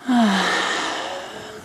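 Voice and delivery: long open sigh trailing away; empty the lungs in one audible sigh; big sigh out. Shown as a demonstration. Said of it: "a sigh out".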